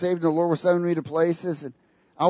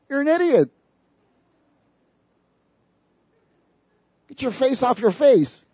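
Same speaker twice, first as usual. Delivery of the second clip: exasperated mock scolding, higher; scolding outburst; 8 semitones higher than usual. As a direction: snap at them, half joking. Preacher scolds selfie posters.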